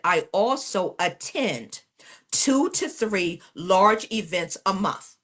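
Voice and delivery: very slow, each word spaced; slow deliberate quote. Retold or imitated. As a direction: spell it out slowly for the class.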